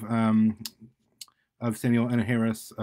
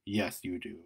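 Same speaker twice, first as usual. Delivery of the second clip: low, much softer menacing voice; low menace; 5 semitones lower, 8 dB quieter than usual. Acted. Dark figure pressing Carl, low soft menace. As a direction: say it quietly and darkly. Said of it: "said the dark figure".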